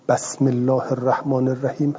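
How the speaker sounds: بسم begun with an open "ba"; short a after the ب in بسم, giving "basm"; fatha on the ب. Shown as a demonstration.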